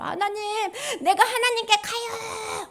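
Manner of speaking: high, slow, crying call upward; tearful cry to God. Acted. (울먹이며 하나님을 부르짖는 외침)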